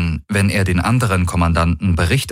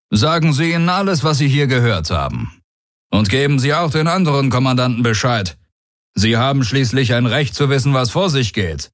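higher, brisk warlord giving orders; commanding warlord; 6 semitones higher than usual. Acted. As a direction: dismiss the threat, then command. Daraios sends message.

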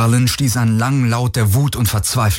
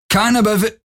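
barking guard order; 12 semitones higher than usual. Acted.

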